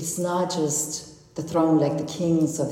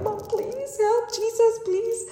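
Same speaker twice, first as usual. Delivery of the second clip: desperate pleading. Retold or imitated. Passenger begs Mom and Jesus during landing, pleading. Retold desperate plea on plane.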